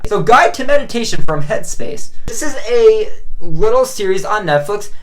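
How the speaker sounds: ordinary delivery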